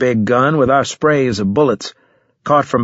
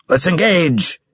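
higher-pitched combat command; called order; 6 semitones higher, slower than usual. A narrator's reading. Borges ordering the squad to engage, calling out. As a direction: call the squad into the fight.

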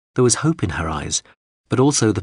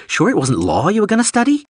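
sarcastic jab; 13 semitones higher, 4 dB louder than usual. A narrator's reading. Yalson mocking the drone.

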